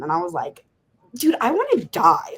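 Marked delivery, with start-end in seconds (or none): 1.1-2.3 high-pitched, dramatic despairing outburst; retold despair. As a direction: act out giving up, exasperated. Acting out despair to parole officer.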